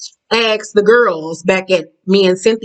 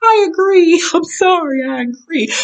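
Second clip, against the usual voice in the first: high, giggling through the words; laughing agreement; 8 semitones higher than usual. Real, not acted. Vlogger agreeing with the shade, giggling. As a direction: agree while still laughing.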